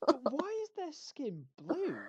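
high, loud, slow question; baffled questioning. Retold or imitated. Pupil asking teacher about blue skin, loudly baffled. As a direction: stretch it out in hammed-up bewilderment.

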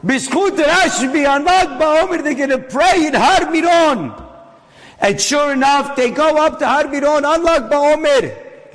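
high, ringing sermon climax; preaching at full force. Acted. Preacher telling of the Miron prayer at full force. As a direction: preach it with fire.

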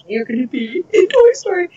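high, gushing good news; retold excitement. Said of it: "So I was pretty excited about that".

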